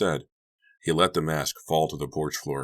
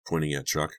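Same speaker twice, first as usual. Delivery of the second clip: usual speaking voice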